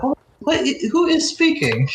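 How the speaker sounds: high-pitched, startled questioning; baffled alarm. Acted. Scholar hears an unseen speaker, startled and high. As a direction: pitch jumps up, bewildered scholar.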